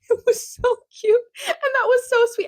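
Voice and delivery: bubbly high-pitched gushing; gushing delight. Real, not acted. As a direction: squeal it like the present is the cutest thing ever. Gushing over a gift.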